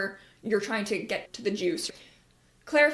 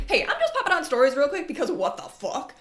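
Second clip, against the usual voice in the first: laughing rant; 5 semitones higher, 4 dB louder than usual. Retold or imitated. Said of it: "where you'll be like".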